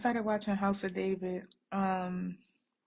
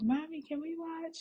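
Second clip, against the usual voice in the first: put-on higher little-girl voice; daughter asking; 9 semitones higher, 3 dB quieter than usual. Mom voicing her daughter asking to watch, higher childlike. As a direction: mimic a small girl begging her mom. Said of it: "She be like".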